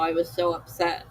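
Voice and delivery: ordinary talk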